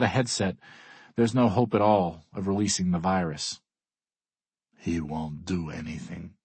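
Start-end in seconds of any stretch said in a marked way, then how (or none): 4.7-6.3 slow, quiet, contemptuous sneer. A narrator's reading. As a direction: make it sound insulting. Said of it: "Alexei sneers".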